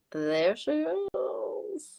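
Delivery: high, drawn-out there she is; excited greeting. Real, not acted. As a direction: greet her with delight. Guest appears on video.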